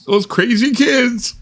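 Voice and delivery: high, slow exasperated whine; exasperated neighbour. Acted. Annoyed neighbour complaining about summer kids, high and slow. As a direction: stretch it up high in mock exasperation. Mock old neighbour exclamation.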